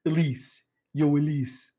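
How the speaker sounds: a failed attempt at an Italian accent, barely shifted; half-hearted Italian accent on the greeting; bad Italian accent. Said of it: "he does not sound like that".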